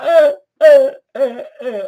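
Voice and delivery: high, loud, hammed-up strangled cries; mock death screams. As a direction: squeal out a cheesy dying scream. Actress screams as she's killed.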